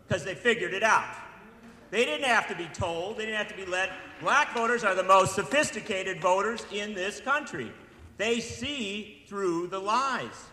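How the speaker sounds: loud, building orator's cry; loud and emphatic, slowing at the end; rising rally voice. Real, not acted. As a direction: drive it home to the crowd, slowing on the last line. Rousing crowd at a rally.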